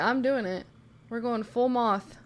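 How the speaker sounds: usual speaking voice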